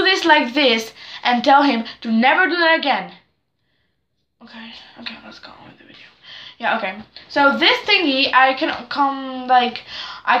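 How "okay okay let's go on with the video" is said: much softer, breathy whisper to herself; whispered aside. Real, not acted. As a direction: drop to a whisper and move on. Muttered aside to move on.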